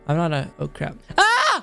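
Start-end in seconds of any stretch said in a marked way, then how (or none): 1.2-1.6 short high-pitched scream; sudden high yell at a fall; startled yell. Real, not acted. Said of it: "Sorry for the yelling".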